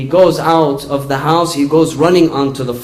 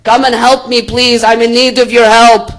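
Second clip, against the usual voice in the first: high, loud cry for help; acted yell; 7 semitones higher, 6 dB louder than usual. Mouse runs to the goat for help, acted yell. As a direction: raise pitch and volume, urgently calling for help. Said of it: "He begins to yell".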